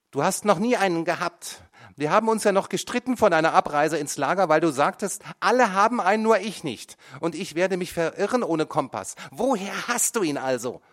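high, loud, relentless accusation; accusing mother. A narrator's reading. Mother interrogates her son, high and loud. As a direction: corner him, pile it on.

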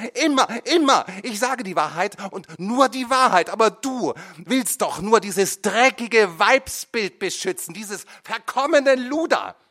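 high, loud, raging tirade; furious rant. A narrator's reading. Mother rages at her son in court. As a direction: hurl every word at him.

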